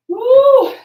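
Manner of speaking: loud high whoop; excited whoop. Real, not acted. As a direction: let out a big whoop. Mid-workout cheer of effort.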